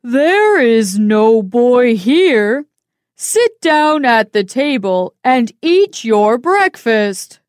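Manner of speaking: loud, steady giantess; giantess covering up. Acted. Giant woman hiding Jack from her husband, firm and loud. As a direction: brush him off firmly.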